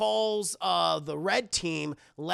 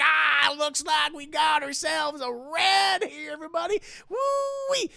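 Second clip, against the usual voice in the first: gloating holler; 8 semitones higher, 6 dB louder than usual. Acted. Blue team catching Tyler, gloating holler. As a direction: whoop it up for the whole gang. Captors crow over catching a red.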